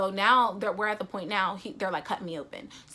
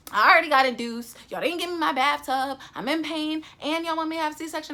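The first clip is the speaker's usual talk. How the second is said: higher, louder, outraged complaint; indignant rant; 7 semitones higher, 6 dB louder than usual. Retold or imitated. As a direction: vent the outrage, voice climbing. Outraged rant about C-section.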